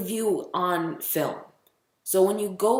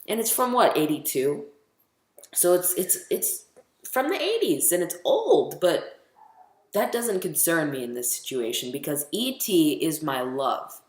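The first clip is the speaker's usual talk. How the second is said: usual speaking voice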